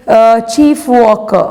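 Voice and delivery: usual speaking voice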